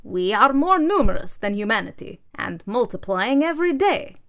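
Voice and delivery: high, loud, slow oratory; rallying manifesto. Acted. Manifesto rousing the junk pile, loud and slow. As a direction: build the grievance list by list.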